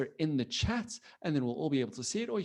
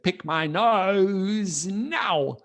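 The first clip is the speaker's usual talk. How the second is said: louder, hugely drawn-out silly order; mock command; 4 semitones higher, 5 dB louder, much slower than usual. Kid orders the computer to do something silly, mock bossy. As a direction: stretch it out like bossing a machine. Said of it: "If you said to it".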